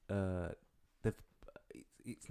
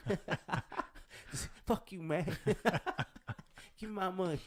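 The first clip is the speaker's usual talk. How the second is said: high-pitched American robber, breaking into laughs; hammed-up American stick-up voice; 7 semitones higher than usual. Robber threatening the betting shop clerk, mock American. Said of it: "you just stereotyped all of America".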